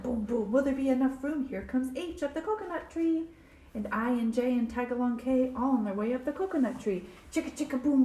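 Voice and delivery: ordinary delivery, nothing marked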